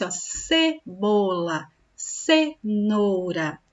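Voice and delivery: "cebola, cenoura" drawn out slowly, the C said like S; soft C as S. Shown as a demonstration. Teacher showing pupils that C before E sounds like S. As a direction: stretch each word so the soft "ce" is heard clearly. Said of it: "Fica com o sonzinho do S".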